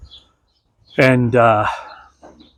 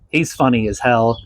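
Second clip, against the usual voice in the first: usual speaking voice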